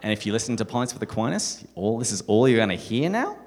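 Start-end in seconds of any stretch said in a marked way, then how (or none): 1.7-3.4 loud mock upspeak, "now" soaring at the end; rising question tune. Shown as a demonstration. Warning podcast listeners about Australians, with an exaggerated rise on "now". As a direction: turn the statement into a question on "now". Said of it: "go up at the end of every sentence".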